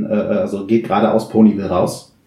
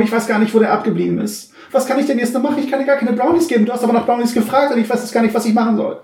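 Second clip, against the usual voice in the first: worried shopkeeper; 12 semitones higher, faster than usual. Acted.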